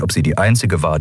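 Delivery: usual speaking voice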